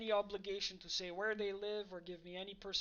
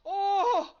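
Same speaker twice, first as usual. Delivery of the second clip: excited shout; 12 semitones higher, 17 dB louder than usual. Real, not acted. Big moment in the game.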